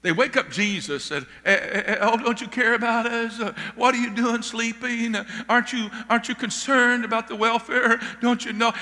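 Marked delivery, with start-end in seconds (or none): 1.4-8.7 a touch of raised, hurried panic; panicky disciples. Acted. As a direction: play the scared disciples lightly pleading. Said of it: "They're so scared and panicking".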